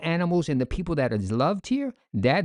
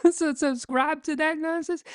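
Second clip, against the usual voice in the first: much higher, laughing through the words; laughing disbelief; 13 semitones higher than usual. Real, not acted. Mocking the paid subscription, laughing in disbelief. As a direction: laugh it off as absurd.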